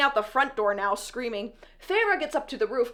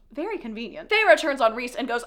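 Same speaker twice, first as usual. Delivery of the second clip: ordinary talk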